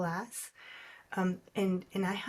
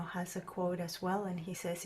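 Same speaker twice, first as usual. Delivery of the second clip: ordinary delivery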